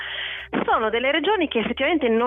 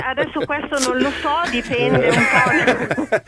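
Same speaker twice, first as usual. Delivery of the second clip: laughing; 4 semitones higher, 4 dB louder, slower than usual. Real, not acted. Guest laughs at silly question.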